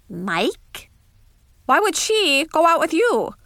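lightly voiced roommate in disbelief; disbelieving roommate. A narrator's reading. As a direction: question him incredulously, pitch climbing.

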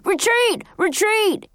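high-pitched battle cry of retreat; yelled. A narrator's reading. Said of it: "John yelled".

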